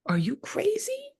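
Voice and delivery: mock outrage, way up high and slow; hammed-up shocked squeak; shocked high squeal. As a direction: squeal it in fake disbelief. Mock shocked reaction to newcomer.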